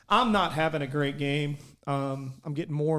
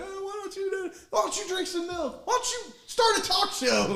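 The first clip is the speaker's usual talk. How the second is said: mock heckling. Player replaying the back-and-forth between dugouts, mock-yelled heckles. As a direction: toss jeers as if yelling between dugouts.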